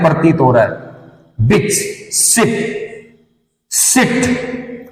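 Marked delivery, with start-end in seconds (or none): 1.5-1.7 बिच said instantly, short i; short vowel. Shown as a demonstration. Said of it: "तुरंत प्रभाव से". 2.1-2.8 सिप said instantly, short i; soft, quick सिप with a tiny vowel. Shown as a demonstration. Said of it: "तुरंत प्रभाव से". 3.7-4.9 सिट said instantly, short i; soft, quick सिट with a tiny vowel. Shown as a demonstration. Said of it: "तुरंत प्रभाव से".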